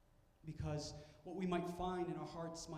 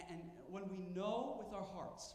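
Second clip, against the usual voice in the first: ordinary delivery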